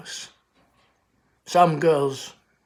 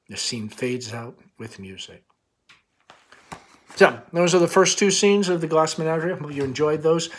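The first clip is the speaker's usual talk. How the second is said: usual speaking voice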